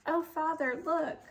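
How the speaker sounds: can't believe what he sees; astonished. A narrator's reading. Sexton points at the statue.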